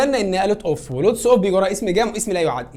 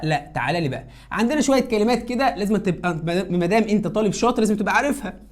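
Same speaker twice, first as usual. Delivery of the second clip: ordinary talk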